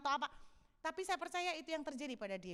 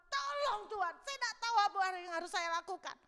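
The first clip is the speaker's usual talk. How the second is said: raised, urgent plea; crying out; 7 semitones higher than usual. Acted. David lost, crying out to God. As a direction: call out high for help. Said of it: "Teriak-teriak, dia berteriak sama Tuhan".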